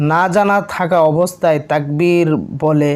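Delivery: normal talking voice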